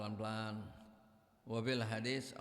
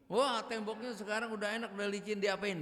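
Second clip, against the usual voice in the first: admiring villager; 8 semitones higher, 4 dB louder than usual. Acted.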